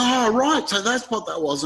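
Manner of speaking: a jump up in pitch as it dawns on you; retelling the moment it clicked, half-laughing; surprised realisation. Retold or imitated. Telling the host about the ghost, a sudden surprised high oh.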